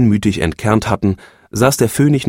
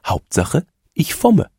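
hohe, lockende Frauenstimme; die Phönigin; 8 semitones higher, slower than usual. A narrator's reading. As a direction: biete es ihr verführerisch an. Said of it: "sagte die Phönigin". (die Phönigin lockt ihren Mann, hoch und verführerisch)

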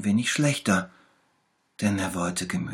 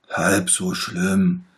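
slow, lightly voiced, louder little bear; calm him down, slowly and warmly; 7 dB louder, much slower than usual. A narrator's reading. Little bear comforts the sick tiger, slow and louder. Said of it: "sagte der kleine Bär".

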